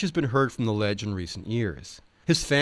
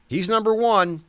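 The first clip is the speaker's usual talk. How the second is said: higher, louder eager cowboy voice; imitating the Ledge; 10 semitones higher, 5 dB louder than usual. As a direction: insist like the quirky cowboy. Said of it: "The Ledge advised".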